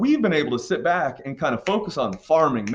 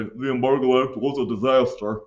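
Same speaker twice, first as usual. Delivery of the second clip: put-on voice parroting a simplistic answer; mock rote answer. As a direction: imitate a student just saying it. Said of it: "I don't want your if it didn't to just say".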